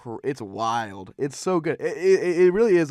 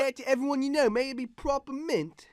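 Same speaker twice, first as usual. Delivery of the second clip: high, bad British accent; 12 semitones higher than usual. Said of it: "Trying to do a British accent".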